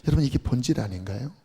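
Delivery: normal talking voice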